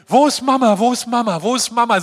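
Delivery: put-on high, loud panicky child calling for mama; panicked child. Child lost at the Christmas market, panicking for mama. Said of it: "Wie so ein Kind ... plötzlich Panik kriegt".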